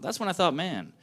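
ordinary delivery

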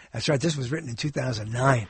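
usual speaking voice

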